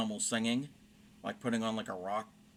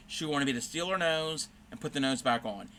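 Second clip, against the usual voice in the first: usual speaking voice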